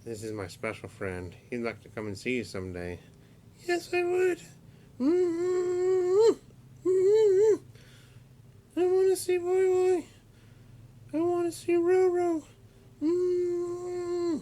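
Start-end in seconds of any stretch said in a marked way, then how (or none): 3.7-14.4 very high, louder squeaky puppet voice; monkey puppet voice. Acted. Uncle voicing Fred the monkey puppet for nephews, very high squeaky voice. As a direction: squeak it out like a little toy monkey. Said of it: "He's a monkey".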